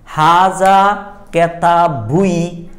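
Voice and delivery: হাজা কেতাবুই drawn out slowly, every syllable sounded; a teacher carefully sounding out the Arabic phrase; slow Arabic recitation. Shown as a demonstration. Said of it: "এখন হাজা কেতাবুই যদি পড়ি".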